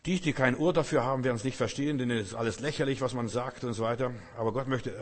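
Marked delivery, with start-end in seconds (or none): none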